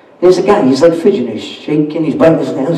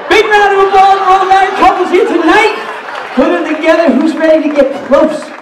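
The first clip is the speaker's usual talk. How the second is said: host hype; 10 semitones higher than usual. Acted. Host asks the crowd to cheer for married couples. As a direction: pump up the room like a party host.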